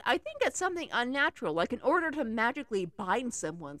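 ordinary delivery

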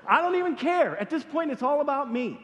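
high, emphatic retort; self-centered outburst. Retold or imitated. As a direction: blurt it out high and insistent. Retold frantic outburst on the rock.

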